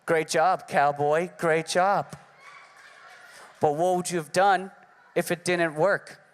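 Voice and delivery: low, dry mock-praise; deadpan the praise, then question; sarcastic mother. Comic voices his daughter's mother mocking him after the roast, low and dry.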